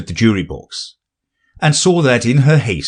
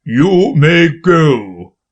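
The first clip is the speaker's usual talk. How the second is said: slow, loud royal dismissal; king voice; 6 semitones higher, 4 dB louder, much slower than usual. A narrator's reading. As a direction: draw it out like a king.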